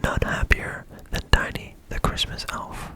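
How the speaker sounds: plain reading voice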